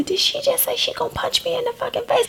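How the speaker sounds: put-on high, stunned little child; shocked kid voice. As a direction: gasp it out like a kid in disbelief.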